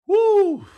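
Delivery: loud high whoop; excited woo. Real, not acted. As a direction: let out a sudden hype whoop. Hyped about Denzel Boston.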